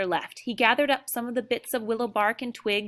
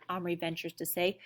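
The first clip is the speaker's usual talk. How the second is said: plain reading voice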